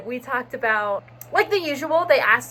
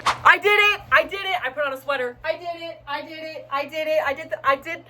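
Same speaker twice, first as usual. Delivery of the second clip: breathless, high I did it; excited relief; 6 semitones higher than usual. Real, not acted. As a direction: gush with relief. Just finished the interview.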